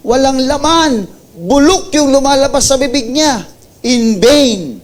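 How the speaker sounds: high, loud, impassioned preaching; preaching at full force. Acted. Pastor rebuking empty worship to the congregation, full-force preaching. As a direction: pound every word home from the pulpit.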